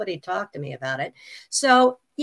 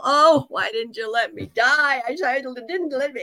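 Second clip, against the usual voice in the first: mock complaining whine; 5 semitones higher than usual. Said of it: "I complained so much".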